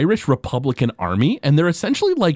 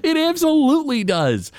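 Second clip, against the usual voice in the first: laughing. Real, not acted. Laugh heard on the line.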